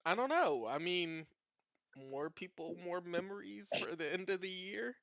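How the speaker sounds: a hint of a carefree roommate; lightly voiced Apollo. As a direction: shrug it off brightly. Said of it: "Apollo is just like".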